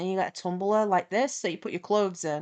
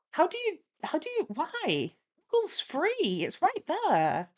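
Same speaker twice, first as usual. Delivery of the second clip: high-pitched, baffled sputtering; exasperated disbelief; 8 semitones higher than usual. Real, not acted. Storyteller baffled he never Googled it, in exasperated disbelief. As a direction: break off, then protest in squeaky bewilderment. Baffled rant about the flatmate's ignorance.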